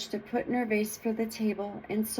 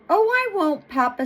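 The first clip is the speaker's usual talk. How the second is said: put-on high, louder little-girl voice; little girl promising; 8 semitones higher, 5 dB louder than usual. A narrator's reading. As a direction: promise papa in an eager high voice. Little girl promising her papa.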